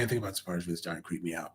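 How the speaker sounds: hushed spooked aside; creeped-out murmur. Real, not acted. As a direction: mutter it fast like it's getting under your skin. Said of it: "Oh man".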